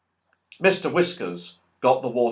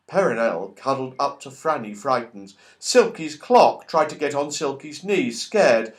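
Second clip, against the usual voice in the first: ordinary delivery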